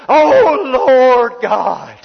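high, loud, fervent cry to God; imitating the landlord's booming prayer; fervent Pentecostal prayer voice. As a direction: cry out to the Lord like an old-time Pentecostal man. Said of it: "that's how he sounded".

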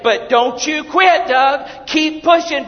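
high, loud, slow command; full-force preaching. Acted. Preacher voicing God's command loudly. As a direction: hammer each word.

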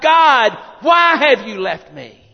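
high, loud shout falling away on the question; big anguished shout, preached; crying out. Acted. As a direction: cry out to heaven, forsaken. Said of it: "and he cried out".

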